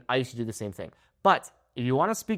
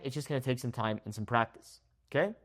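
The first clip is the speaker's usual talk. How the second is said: normal talking voice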